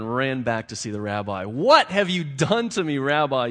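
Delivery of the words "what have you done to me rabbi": loud, higher, anguished cry; cried out. A narrator's reading. Poor man crying out to the rabbi. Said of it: "he cried".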